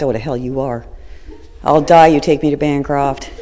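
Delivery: loud, half-laughing refusal; retold loud protest with laughter. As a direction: bark the demand through a laugh.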